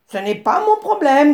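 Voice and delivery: réplique sèche, plus haute et plus forte; envoie-le promener; grognement du babouin. A narrator's reading. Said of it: "grogna le babouin". (grogne au nez du zèbre)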